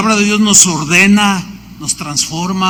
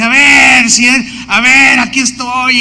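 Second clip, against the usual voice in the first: loud, higher, taunting yell at the sky; mocking defiant shout; 6 semitones higher, 6 dB louder than usual. Pastor retells the former satanist taunting God, defiant shout. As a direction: shout a challenge like you're daring God. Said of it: "me empecé a burlar de Dios".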